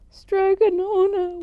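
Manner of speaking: slow, very high, loud wail of grief; weeping. Acted. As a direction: wail her name, drawn out. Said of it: "wept Antonia".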